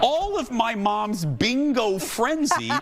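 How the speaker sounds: slow, climbing comic outrage; mock-indignant reveal. Real, not acted. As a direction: stretch out the punchline, pitch climbing high. Storyteller's indignant punchline reveal.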